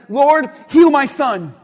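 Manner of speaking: loud, drawn-out mock command to Jesus; bossy demand. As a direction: act out ordering the Lord, slowly and loudly. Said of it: "still telling Jesus what to do".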